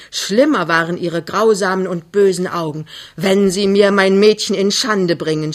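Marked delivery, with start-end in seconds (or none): 3.2-5.5 angry, louder outburst; beside herself, shouting. Acted. Mother threatens daughter's suitor, shouting in outrage. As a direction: burst out at him in outrage. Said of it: "schrie sie außer sich".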